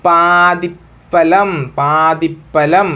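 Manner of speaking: പാതിഫലം stretched out very slowly, twice; teacher dictating to students; slow drill. Shown as a demonstration. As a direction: drag out പാതിഫലം so the class can write it. Teacher drills the joined word.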